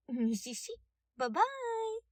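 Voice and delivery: high, bright bye-bye; sweet mocking farewell; singsong goodbye. A narrator's reading. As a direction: wave him off cheerfully. Detective waves goodbye.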